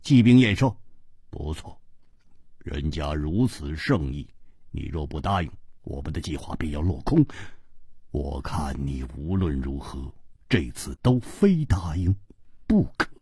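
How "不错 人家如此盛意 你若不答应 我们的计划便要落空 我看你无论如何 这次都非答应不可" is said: much lower and softer, calm and firm; a cold, steady companion pressing him; cool 季冰燕 voice. Acted. 季冰燕 held low voice. (低沉冷静，不容推辞)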